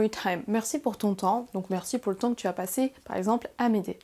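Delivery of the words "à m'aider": usual speaking voice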